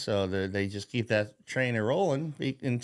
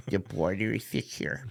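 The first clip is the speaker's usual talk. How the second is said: softer, flat mock official drone; mocking Mayorkas; 3 dB quieter than usual. Mocking the border chief's stock answer, softer drone. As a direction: mumble it like a smug bureaucrat. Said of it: "That's exactly how he'd fucking sound".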